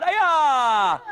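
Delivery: high, loud drawn-out kiai; martial arts yell. Shown as a demonstration. Coach shows kids a front kick, loud kiai yell. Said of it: "yell out".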